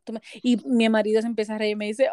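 ordinary talk, nothing marked

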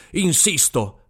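loud, stubborn demand; insisting loudly. Acted. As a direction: shout it right into his ear. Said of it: "disse ad alta voce ... strillandogli proprio nell'orecchio".

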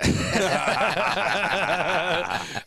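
high, rolling burst of laughter; sustained high cackling laugh; long laugh. Real, not acted. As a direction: laugh hard and keep it going. Group joking about brand name.

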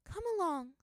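high, soothing mother voice; mother calmly gathering her daughter; gentle mother. A narrator's reading. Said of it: "said Poppy, her mother".

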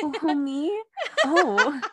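high, giggly, flattered swoon. Pretend. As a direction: act bashful and thrilled. Pretending to be chosen, swooning.

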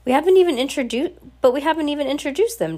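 high, fast objection; protesting. A narrator's reading. Husband objects as his wife hurries off, protesting.

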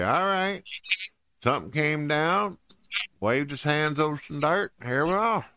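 high, lilting comic quote; casual storyteller quote. Acted. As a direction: play it as a lighthearted, high-voiced retelling. Acting out someone's casual creation account.

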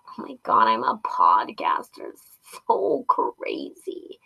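breathless giddy whisper; excited whisper. Real, not acted. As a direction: whisper it, thrilled at yourself. Giddy aside about being podcaster.

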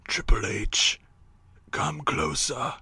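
slow, whispered mock deathbed voice; dying boss impression. Dying boss summoning Triple H, slow mock whisper. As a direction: beckon in a hammed-up failing whisper. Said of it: "Why are you talking like that?".

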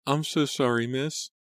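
high-pitched timid maid; small, high servant's voice; quiet apology. A narrator's reading. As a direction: apologize meekly in a high little voice. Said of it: "said quietly".

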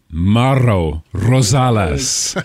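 Mauro Rosales with rolled, trilled R's. Shown as a demonstration. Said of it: "when I would roll those R's".

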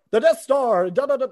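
big hammed-up title reveal; opening crawl readout. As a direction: belt out the title and theme like a fanfare. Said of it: "reading out the opening text".

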